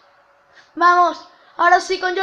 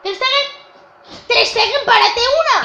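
pleading call; 6 semitones higher, 5 dB louder than usual. Real, not acted. Pleading to his keeper.

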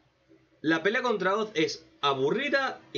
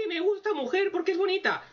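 high, silly put-on voice of a creepy villain; mocking villain voice; 13 semitones higher than usual. Reviewer mocks the villain's reason, in a high silly voice. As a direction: parody the villain's dumb motive.

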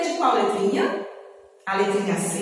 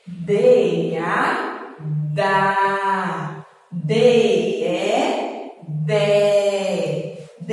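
syllable drill; much slower than usual. Shown as a demonstration. Teacher spelling out the D family for children, slow syllable drill. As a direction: stretch every syllable for learners to follow.

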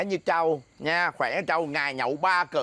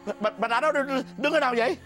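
spooked stammer; 6 semitones higher than usual. Acted. Man spooked by woman standing there.

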